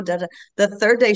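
ordinary talk

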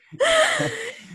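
sudden high, loud laugh; a short real laugh at the absurdity; high-pitched laugh bursting out; burst of laughter. Real, not acted.

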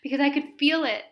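recalling the husband's love, crying now; crying while telling. Real, not acted. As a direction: push through real crying. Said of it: "I'm crying right now as I say this".

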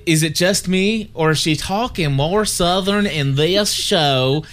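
slow, hammed-up Southern drawl; slow, overdone Southern twang; exaggerated Southern accent. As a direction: lay on a mock Southern accent, stretching the words. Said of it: "with more of a Southern accent".

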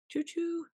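high, playful choo-choo; a silly high train call; train whistle imitation. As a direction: toot it like a toy train. Playful steam train joke.

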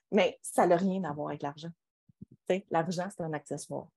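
ordinary delivery

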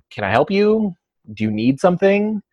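imitating a wary client, high-pitched questions; suspicious, standoffish. Client questioning the trainer at their door, suspicious and standoffish. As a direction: mimic a guarded homeowner, pitched well up. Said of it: "concerned and very standoffish".

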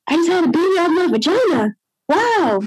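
high, quick and astonished; amazed. Retold or imitated. New mother to herself, astonished and high. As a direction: marvel at what just happened.

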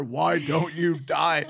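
slow, drawn-out mock yell; retold dad yelling. Retelling dad telling the spider off, mock slow yell. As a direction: stretch each word like an exasperated dad. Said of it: "Dad's trying to s-, tell the spider".

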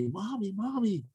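imitating a small child, high-pitched and eager; high little-kid voice, rising at the end; little boy. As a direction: pipe up like a young son asking Mommy. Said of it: "my little son asked me".

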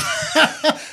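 bursting out laughing; high laugh of disbelief. Real, not acted. Laugh at the admission.

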